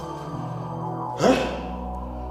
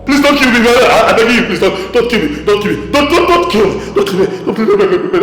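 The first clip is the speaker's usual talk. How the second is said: rapid, repeated frightened pleas; words tumbling out in terror; panicked begging. Acted. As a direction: beg frantically for your life. Begs ghost for his life.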